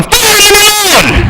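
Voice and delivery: hammed-up high, drawn-out yell; exploding in irritation. Asked how you're doing at the van, exploding. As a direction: burst out at an innocent question, stretched and high. Snapping after brooding all day.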